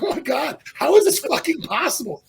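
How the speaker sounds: high-pitched, incredulous self-talk; mock exasperated disbelief. Shaking athlete laughing at himself, exasperated disbelief. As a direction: marvel in pain at your own weakness.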